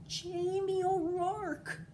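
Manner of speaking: high, slow, drawn-out call to her husband; long high nagging call; wife calling out. A narrator's reading. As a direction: call him like a fed-up Irish wife. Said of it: "his wife Eileen would say".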